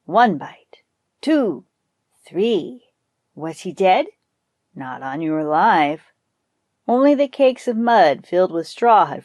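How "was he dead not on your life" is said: usual speaking voice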